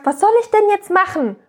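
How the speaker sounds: mock helpless outburst at his wits' end; a hint of an annoyed, helpless father; exasperated partner. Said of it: "aggressiv und sauer".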